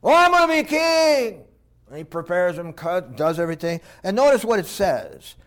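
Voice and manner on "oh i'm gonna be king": high, loud, gloating voice; gloating prince. Acted. Adonijah gloating he will be king. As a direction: crow over the crown.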